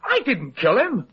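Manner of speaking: high, slow, pleading protest; begging to be believed; frightened insistence; desperate denial. Acted. Accused of murder, pleads.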